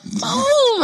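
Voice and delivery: impact sound, shouted in a high pitch; high, punched "boom". Retold or imitated. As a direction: let the blow burst out. Imitates the second blow.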